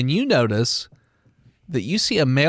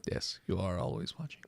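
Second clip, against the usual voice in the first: creepy whisper; 9 semitones lower, 17 dB quieter than usual. Acted. Echoing creepy neighbors.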